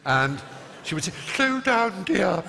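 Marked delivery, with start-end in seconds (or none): 1.3-2.4 put-on high ingressive old lady; breathing-in voice. Elderly coach reining in hyper pupil, high breathing-in voice. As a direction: gasp it inward, high and slow. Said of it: "she was breathing in when she spoke".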